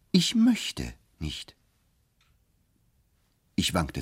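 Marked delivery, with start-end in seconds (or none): 0.1-1.5 flat refusal. Acted. Bartleby refusing flatly.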